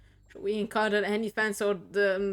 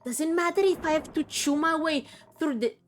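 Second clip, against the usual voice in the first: put-on higher, fierce undead character accent; defiant Rhea's accent, a touch higher; 3 semitones higher, 4 dB louder than usual. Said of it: "I love doing the Rhea's accent so much".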